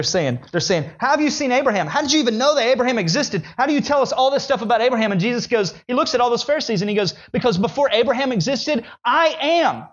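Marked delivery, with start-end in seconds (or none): 1.0-5.1 much higher, fast incredulous questions; pressing him hard; demanding. Acted. Pharisees demanding, voiced high. 7.3-9.9 much higher, ringing claim; Jesus answering the Pharisees; climactic declaration. Acted.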